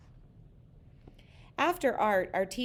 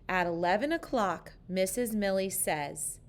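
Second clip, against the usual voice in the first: ordinary delivery, nothing marked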